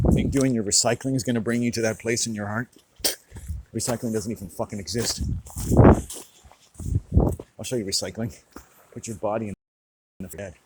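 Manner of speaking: loud, confrontational rant; angry scorn. Real, not acted. Angry rant about recycling.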